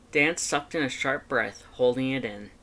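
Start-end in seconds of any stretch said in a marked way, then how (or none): none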